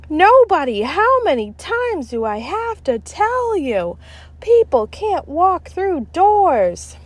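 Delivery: high, slow lecturing voice; scolding know-it-all. A narrator's reading. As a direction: scold the reader like a teacher. Narrator scolds reader.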